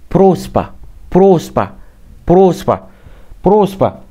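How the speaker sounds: بروسبا said high and clear; repeat after me. Shown as a demonstration. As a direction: bruspa said for repetition. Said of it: "اول حاجه ايه".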